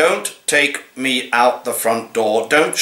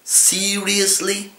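drawn-out disbelief; 8 semitones higher, 4 dB quieter, slower than usual. Acted. Cat poem, repeated disbelief.

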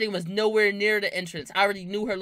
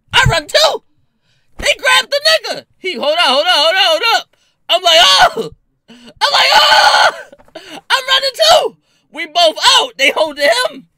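very high, loud, laughing shouts; laughing hysterically; 14 semitones higher, 16 dB louder than usual. Real, not acted. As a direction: shriek through laughter as you tell it. Excited retelling of the escape.

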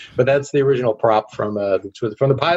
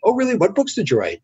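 high, eager surprised interest; bubbly retold curiosity; very happy waiter; 8 semitones higher than usual. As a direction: imitate an impressed, chatty waiter. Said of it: "very happy guy says".

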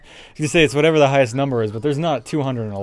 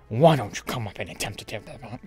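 villain voice; 4 semitones lower, 3 dB quieter than usual. Pretend. Voicing boss Capital B.

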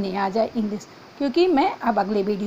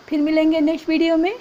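quick, bright sign-off, a little louder; cheery goodbye; 5 semitones higher, 4 dB louder than usual. Real, not acted. Teacher cheerfully saying bye to students at video end, quick and upbeat. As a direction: wave the class off happily.

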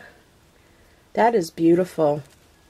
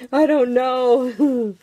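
laughing; 6 semitones higher, 3 dB louder, much slower than usual. Real, not acted. Laughs at own remark.

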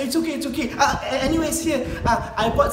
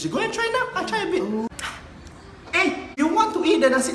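eager urging; 8 semitones higher, faster than usual. Acted. Urging guest to taste.

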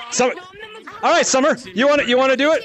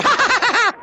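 high, triumphant laughing; gleeful laugh; 11 semitones higher than usual. Real, not acted. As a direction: burst out laughing at the catch. Laughs after tagging someone.

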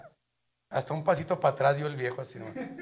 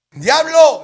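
loud, high call into the night; shouting; 12 semitones higher, 17 dB louder than usual. Acted. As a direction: yell out to the devil. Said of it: "le empezó a gritar". (grito al diablo)

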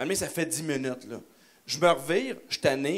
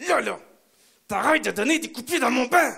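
loud, higher scolding yell; stern yell; 8 semitones higher, 5 dB louder than usual. Retold or imitated. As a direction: bark it at the kid. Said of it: "puis je fais".

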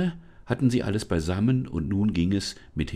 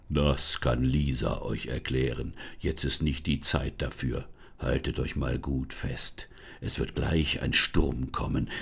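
put-on low, relieved forest spirit greeting the girl; much lower, a glad otherworldly spirit; deep forest spirit, relieved to see Lisa; 7 semitones lower than usual. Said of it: "die Stimme des Waldgeistes".

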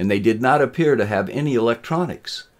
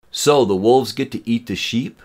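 mildly appalled question, pitched up; disgusted; 4 semitones higher, 4 dB louder than usual. A narrator's reading. Student questioning mob rule, mildly disgusted. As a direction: ask it with a touch of disgust. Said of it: "Brian asked in disgust".

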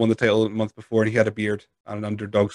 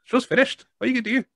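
much higher, mocking voice; mock dismissive brush-off; 12 semitones higher than usual. Wrestler telling an angry fan to leave, mock high whine.

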